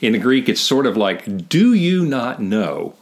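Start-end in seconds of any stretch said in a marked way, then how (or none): 1.5-2.9 slow, drawn-out rhetorical jab; Pauline rebuke. Retold or imitated. Voicing Paul challenging his readers, pointed and slow. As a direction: spell it out slowly, word by word. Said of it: "one of these Pauline things".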